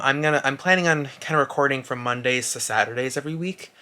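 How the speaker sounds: ordinary talk, nothing marked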